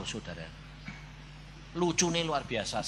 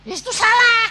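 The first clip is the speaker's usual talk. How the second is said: high, loud yell; forceful, high-pitched rebuke; shouted correction; 10 dB louder than usual. Acted. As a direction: yell that it's wrong. Loudly correcting wrong thinking.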